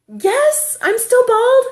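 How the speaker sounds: high, loud mock holler; retold shout to a far-off child; calling back. Mother answering her son across the creek bed, calling out.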